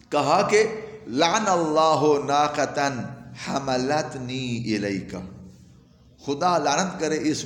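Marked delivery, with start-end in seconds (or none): none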